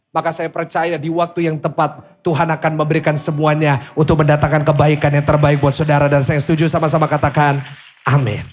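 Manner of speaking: loud, rousing pulpit call; rally the congregation to read along; full-force preaching. Acted. (khotbah berapi-api)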